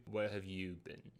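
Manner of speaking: low, quiet stern father; a hint of a stern dad. Dad catches teen coming home.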